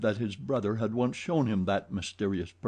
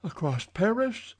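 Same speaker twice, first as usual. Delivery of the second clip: hoarse; 10 semitones higher than usual. A narrator's reading. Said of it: "asked poor Raoul in a hoarse voice".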